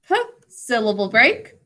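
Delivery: ordinary delivery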